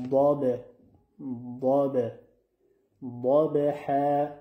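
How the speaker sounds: ضاد stretched slow and loud, tongue edge on molars; drawn-out ضاد ح as a teacher's model; ضاد with emphatic d-sound, not دال; correct Zad. Shown as a demonstration. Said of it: "دقت کنید".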